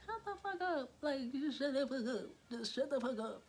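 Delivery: high-pitched whined protest; mock exasperation. Telling her sister to stop, high exasperated mock whine. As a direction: start very high and come down as you repeat it.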